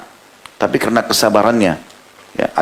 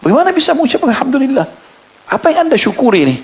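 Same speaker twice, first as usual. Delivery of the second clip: baffled disbelief. Acted. Stranger baffled by the father's thanks.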